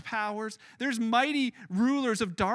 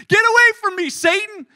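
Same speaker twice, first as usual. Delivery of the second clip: full, high-pitched shout; loud rebuke; 11 semitones higher, 14 dB louder than usual. Acted. Jesus rebukes Peter within earshot of the disciples, loud shout. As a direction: yell it out so everyone around hears. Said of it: "Jesus loudly says".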